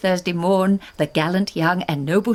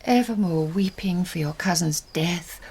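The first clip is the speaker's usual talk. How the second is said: ordinary delivery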